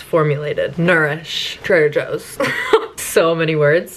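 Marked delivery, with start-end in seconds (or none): 2.4-2.9 very high squeak of a laugh; a quick high giggle; squeaky laugh. Real, not acted. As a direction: giggle at all the words. Laughing at the product label.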